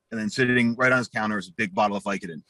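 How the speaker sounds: ordinary talk, nothing marked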